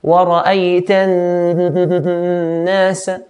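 long hummed n in النَّاسَ, from the nose; ghunna. Shown as a demonstration. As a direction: hold the noon of النَّاسَ in the nose, then release. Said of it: "a sound coming from the nose".

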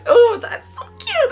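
very high, drawn-out gush; real high-pitched gushing; freaking out. Real, not acted. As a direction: squeal it slowly with delight. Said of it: "I'm sorry, I'm freaking out".

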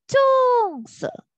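棕色 high and level on 棕, then 色 drops down; flat then falling. Shown as a demonstration. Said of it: "The tone is flat and then going down".

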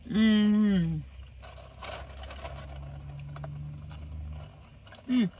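talking while eating; chewing. Real, not acted. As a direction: mouth partly full. Seen 1: Hand holds food, then closed-mouth chewing during final Mm.

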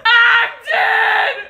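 high, loud yell; real loud outburst; screaming. Real, not acted. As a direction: shout it out after laughing. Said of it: "He's screaming at me".

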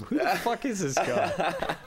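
high slow disbelief breaking into a laugh; exasperated laughing. Retold or imitated. Asking the crew who the late guy is, high and laughing. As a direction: rant while cracking up.

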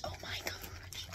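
hushed awed whisper. Real, not acted. Admiring the purse, a hushed whisper. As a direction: breathe it, voiceless. Whispered after loud take.

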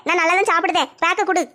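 ordinary delivery

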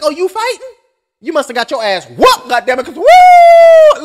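teasing a buddy, rising high into a whooping woo; mocking friend. Friend clowning on the guy who lost the fight, high mock whoop.

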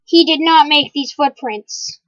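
ordinary delivery

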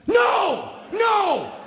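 high, loud cry of denial; acted-out outraged yelp; indignant protest. Burglar denying he's a burglar, loudly indignant.

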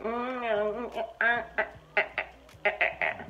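string of doubtful "mm" hums; uneasy gut hums. Retold or imitated. Her gut warning her about this man, doubtful humming. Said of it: "my gut was like".

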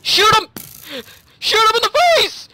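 high, loud battle yell; excited shouting. Real, not acted. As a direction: yell orders in a frantic attack. Excited attack in game.

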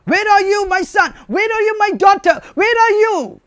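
high, urgent searching call. Acted. Parent searching for a lost son and daughter, calling out. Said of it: "you will give the voice".

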